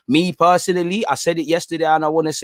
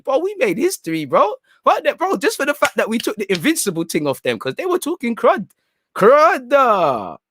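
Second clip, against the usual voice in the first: hyped celebrating; 7 semitones higher than usual. Real, not acted. Answering Marius about celebrating forever, hyped and high. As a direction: crow about it, voice pushed right up high.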